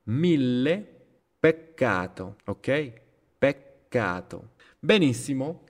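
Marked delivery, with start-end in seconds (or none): 0.0-2.4 long L in mille, stop on CC in peccato; exaggerated double-consonant pause. Shown as a demonstration. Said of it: "la pausa que hago". 3.4-4.5 Peccato slow, with a stop before "cato"; exaggerated double-consonant pause. Shown as a demonstration. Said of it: "la pausa que hago".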